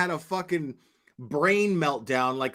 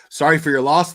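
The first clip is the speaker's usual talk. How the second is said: mock condolence, much louder; sarcastic sympathy; 9 dB louder, faster than usual. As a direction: fake sympathy, loud and sarcastic.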